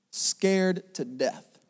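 normal talking voice

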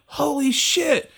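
high, drawn-out starstruck cry; awed amazement. Retold or imitated. Club crowd seeing a famous person walk in, awed amazement. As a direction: stretch it out high, mock starstruck.

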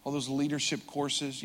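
usual speaking voice

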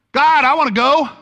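high, loud, raring to go; he can't wait to jump in; eager Peter. Acted. Preacher voices Peter itching to go, high and loud.

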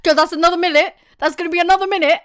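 high, rushed teacher warning; stern teacher. Retold or imitated. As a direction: rattle off the threat like a teacher. Teacher voiced in story.

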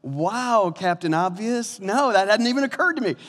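high, loud mock astonishment; hammed-up sarcastic comeback; sarcastic retort. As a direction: play up the fake revelation. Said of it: "I'm like".

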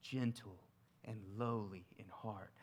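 soft, quiet and lowly; quiet voicing of Jesus, gentle. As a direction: hush it down, tenderly. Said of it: "the state of his heart".

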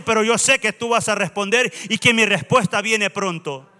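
impassioned sermon, driving and emphatic; fervent preaching. Real, not acted. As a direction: exhort the congregation with full force. Exhorts the congregation to worship.

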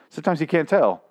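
normal talking voice

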